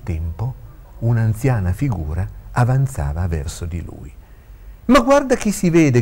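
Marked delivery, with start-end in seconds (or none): 4.9-6.0 high, loud call of surprise; delighted shout, pitched way up; shouted greeting. A narrator's reading. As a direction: call out to an old friend you just spotted. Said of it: "gridò Claudio".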